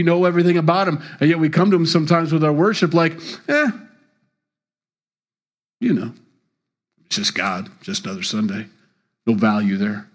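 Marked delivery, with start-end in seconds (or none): 3.5-8.7 softer, shrugging, indifferent; bored shrug. Retold or imitated. A bored churchgoer shrugging off Sunday worship. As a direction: toss it off like it barely matters.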